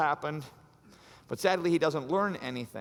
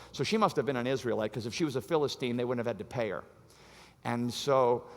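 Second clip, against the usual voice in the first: ordinary delivery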